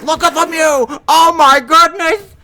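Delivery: hammed-up hockey announcer losing it over a goal; Bob Cole impression. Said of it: "that was a poor Bob Cole interpretation".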